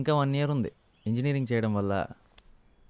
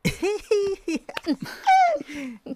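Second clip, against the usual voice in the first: loud high peal of laughter; burst of laughter; 3 dB louder than usual. Acted. Family member bursting out laughing at the son's awkward reaction. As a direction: let a big laugh ring out.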